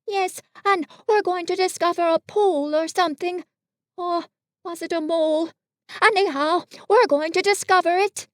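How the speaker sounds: high storybook bear voice; Pooh, vague explainer. Acted. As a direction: muddle through the news, pitched well up.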